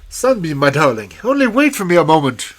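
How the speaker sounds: loud, high call after his love; desperate calling out. A narrator's reading. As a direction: call out across the distance to her. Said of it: "Then he called to her".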